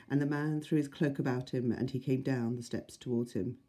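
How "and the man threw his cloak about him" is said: ordinary delivery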